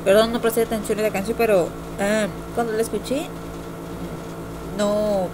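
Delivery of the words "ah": normal talking voice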